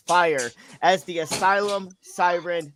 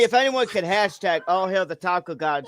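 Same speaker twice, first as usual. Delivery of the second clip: normal talking voice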